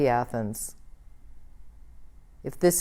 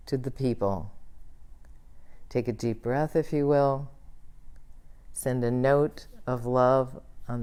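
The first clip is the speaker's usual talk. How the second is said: closing prayer; 3 semitones lower, 5 dB quieter than usual. Acted. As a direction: bless the world gently in prayer.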